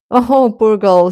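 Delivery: ordinary delivery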